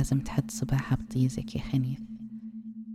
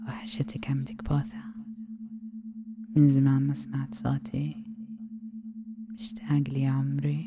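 ASMR whisper. Acted. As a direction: lull him in with a hushed hypnotic whisper.

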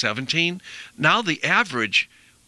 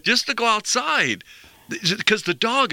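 much higher, lifting with delight; excited; 7 semitones higher than usual. Real, not acted. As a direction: gush like the dog's Christmas came early. The excited dog description continues.

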